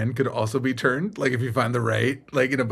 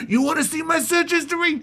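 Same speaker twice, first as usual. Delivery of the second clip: very high, comic voice; squeaky mock confession. Playing a bro admitting curiosity, squeaky and hammed-up. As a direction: push the joke way up in pitch. Repeats the bro joke higher.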